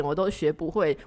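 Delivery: ordinary delivery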